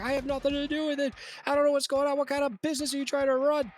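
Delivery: high, fast, indignant denial; acted yelling back. Game master as accused man protesting to Jimmy, high rapid yelling. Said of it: "yelling and yelling".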